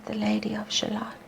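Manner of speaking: quick soft whisper of her name; fast whisper. Acted. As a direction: whisper the name as she dies. Her death.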